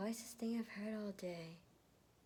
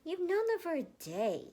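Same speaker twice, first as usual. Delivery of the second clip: dismissive retort; 10 semitones higher, 7 dB louder than usual. Acted. Scoffing at a newcomer.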